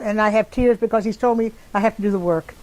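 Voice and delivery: plain reading voice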